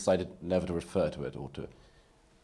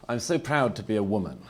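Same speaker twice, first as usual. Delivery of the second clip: a proud voice, raised and mocking; mock proud declaration; 5 semitones higher, 6 dB louder than usual. Speaker mocking identity pride to a debate audience, mock proud declaration. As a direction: parody a self-congratulating speaker. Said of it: "The unsupportable phase is".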